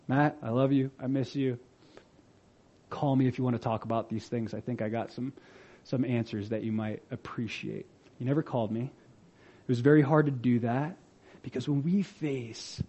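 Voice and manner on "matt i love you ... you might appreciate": tender message. Retold or imitated. His tender message to a friend.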